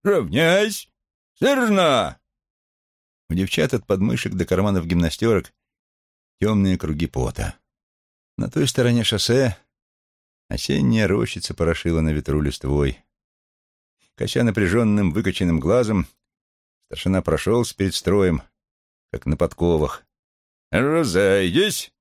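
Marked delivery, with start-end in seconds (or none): 0.0-2.2 ringing high parade commands; shouting drill sergeant. A narrator's reading. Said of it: "звонко вознёс голос". 20.7-21.9 slow drawn-out dismissal call; shouting drill sergeant. A narrator's reading.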